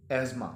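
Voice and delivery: एज़मा as EZ-ma, th silent; correct "asthma". Shown as a demonstration. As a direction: say एज़मा with a buzzing z, no t. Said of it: "इसका असल तलफ़्फ़ुज़ है".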